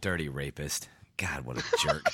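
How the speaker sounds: unvoiced whispered insult; whispering. Real, not acted. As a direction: hiss the aside under your breath.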